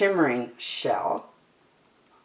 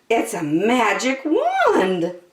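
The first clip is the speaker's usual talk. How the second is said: wonder; 5 semitones higher, slower than usual. A narrator's reading. Ariel guessing about object.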